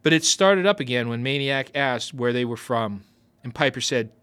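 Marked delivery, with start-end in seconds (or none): none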